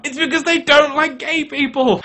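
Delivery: usual speaking voice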